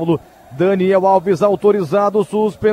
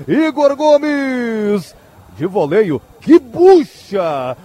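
drawn-out goal call; much slower than usual. Real, not acted. Naming the scorer, stretched.

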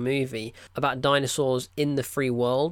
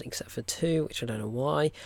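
ordinary talk, nothing marked

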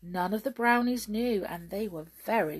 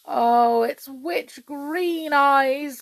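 loud, long drawn-out groan; 6 semitones higher, 9 dB louder, much slower than usual. A narrator's reading. King realising who took Perenelle, groaning. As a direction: groan the words slowly in dismay. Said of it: "groaned the king".

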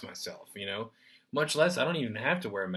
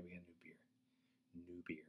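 soft whispered New beer; hushed New beer; 5 semitones lower, 22 dB quieter, faster than usual. Shown as a demonstration. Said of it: "One more time".

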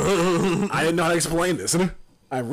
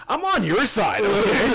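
laughing reassurance; 8 semitones higher, 7 dB louder, slower than usual. Retold or imitated. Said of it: "And it's just like".

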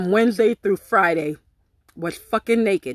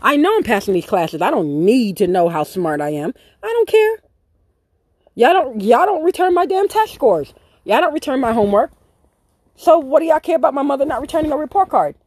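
defiant rant; 6 semitones higher than usual. Retold or imitated. Kid mouthing off to the teacher, high and heated. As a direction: snap back at the teacher with rising heat. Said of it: "I'm like".

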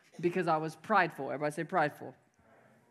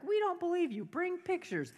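high, put-on chorus of disbelief; mock doubters; 13 semitones higher than usual. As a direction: mimic skeptics in a squeaky-high voice. Mimics the doubting audience.

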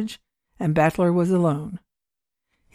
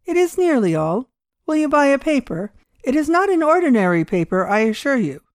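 lightly voiced old man; 6 semitones higher than usual. Old man offers strange paper.

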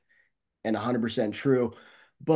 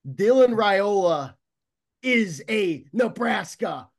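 excited; 7 semitones higher, 3 dB louder, slower than usual. Real, not acted. Big recruiting news announced.

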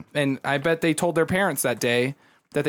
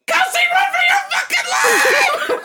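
very high, loud panicked shriek laughing through it; mock panic scream; screaming kid; 6 dB louder than usual. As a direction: shriek it like a terrified little girl. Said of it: "this bitch goes".

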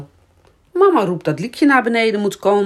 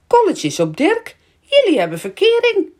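high, sing-out playground teasing; gleeful, high-pitched taunt; teasing child; 14 semitones higher, 4 dB louder, faster than usual. A narrator's reading. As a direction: tease them in a loud little-girl pitch. Child teases friends about being in love.

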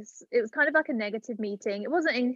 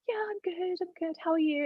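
polite small talk; 9 semitones higher than usual. Retold or imitated. Applicant answering the recruiter's small talk, high and polite. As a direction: act out a breathless-to-know but polite phone answer. Pretends calm, knowing news is coming.

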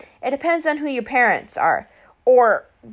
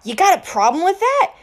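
snide challenge, pitched up; sneer; 6 semitones higher, 4 dB louder than usual. A narrator's reading. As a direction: sneer it at the new kid. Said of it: "Clarice sneered".

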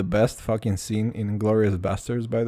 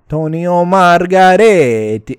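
loud, slow, higher over-the-top Italian; hammy Italian accent; 5 semitones higher, 10 dB louder, slower than usual. Retold or imitated. Showing his sister the right way, loud hammy Italian accent. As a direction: belt the name like a proud fake Italian. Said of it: "And then it's like".

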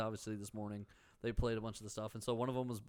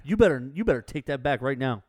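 mock angry; 10 dB louder than usual. Playful mock quarrel over pie.

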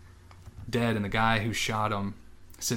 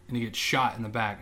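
usual speaking voice

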